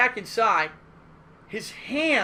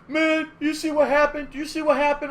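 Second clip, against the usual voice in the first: pissed off; 7 semitones higher, 4 dB louder than usual. Retold or imitated. Angry player complaining to organizer, aggrieved. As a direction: demand he saw it. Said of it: "he's pissed".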